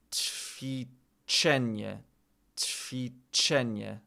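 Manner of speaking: "Ćwiczenie" stretched syllable by syllable, very slow; very slow "Ćwiczenie", each sound drawn out; said slowly. Shown as a demonstration. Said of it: "We just have to say it slow".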